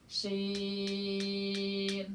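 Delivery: "Sin" held very long on one level note; six-beat elongation. Shown as a demonstration. Teacher demonstrating six harkat to students, "Sin" sustained. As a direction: stretch the vowel of "Sin" steadily for six beats. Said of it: "harus dibaca enam harkat".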